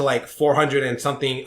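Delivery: ordinary talk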